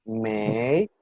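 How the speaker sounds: mei drawn out, starting low and rising; second tone. Shown as a demonstration. Teacher demonstrating the first syllable, mei in a rising tone. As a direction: stretch mei upward like a question. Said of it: "está en segundo tono".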